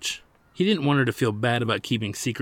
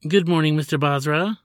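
cheery receptionist; 6 semitones higher, 6 dB louder than usual. Acted. Receptionist greets visitor.

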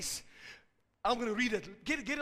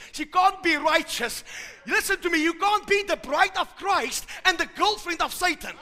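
loud, high, emphatic preacher's rebuke; raised, forceful pulpit voice; full-force preaching; 7 semitones higher, 10 dB louder than usual. Real, not acted. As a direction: shout it out to the congregation.

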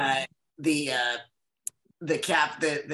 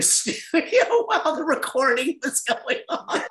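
words breaking up into laughter; helpless real laughter through the words; laughing; 9 semitones higher than usual. Real, not acted. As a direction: crack up while finishing the sentence. Cracking up while telling anecdote.

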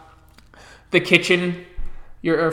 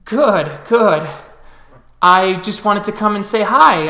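cheerful sister; 4 semitones higher, 6 dB louder than usual. Acted. GM voicing Snickers.

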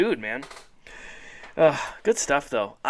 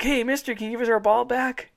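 put-on kids asking; 9 semitones higher than usual. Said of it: "they're like".

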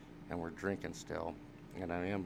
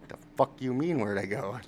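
higher, louder baffled comeback; indignant retort; 5 semitones higher, 7 dB louder than usual. Retold or imitated. Answering the girl at the door, a loud baffled retort. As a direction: push back at her, incredulous. Confused reply to the girl's question.

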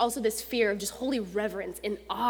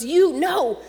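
loud, higher pushy coaching; friend urging; 8 semitones higher, 11 dB louder than usual. Retold or imitated. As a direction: push him to say it, louder and higher. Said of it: "and he goes".

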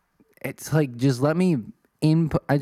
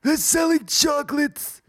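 much higher, slow squeaky reply; high squeaky answer. Acted. Answering the deaf old lady in a squeaky voice. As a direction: squeak it back like a young clerk.

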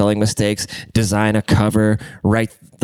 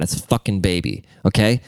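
ordinary talk, nothing marked

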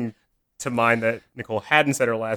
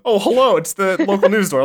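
mock announcer; 6 semitones higher, 5 dB louder than usual.

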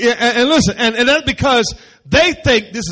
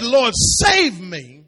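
dramatic plea; 5 dB louder, much slower than usual. Retold or imitated. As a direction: stretch out the cry for rescue. Preacher dramatizes Peter's plea.

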